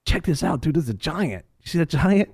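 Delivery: excited David. Retold or imitated. David voiced posting about Goliath.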